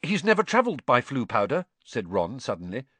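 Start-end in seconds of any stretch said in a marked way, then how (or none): 0.0-1.7 Ron, sudden. A narrator's reading.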